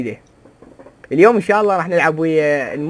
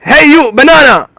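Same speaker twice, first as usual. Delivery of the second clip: taunting call; 6 semitones higher, 7 dB louder, much slower than usual. Acted.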